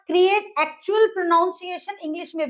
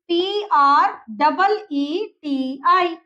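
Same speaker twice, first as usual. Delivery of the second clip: slow spelling; much slower than usual. Shown as a demonstration. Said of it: "क्क्या आ जाएगी इसकी spelling".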